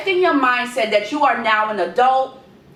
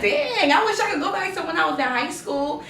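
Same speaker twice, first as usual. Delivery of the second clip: wistful whiny quote; 7 semitones higher than usual. Retold or imitated. Nostalgic wish voiced as quote.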